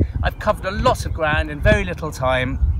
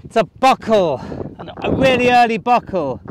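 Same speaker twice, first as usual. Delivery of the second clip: raised voice against a strong gale; shouting over wind; 3 semitones higher, 4 dB louder than usual. Real, not acted. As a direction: project loudly over the wind.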